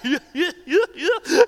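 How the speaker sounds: a loud, hearty mock belly laugh. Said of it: "this deep redneck laugh".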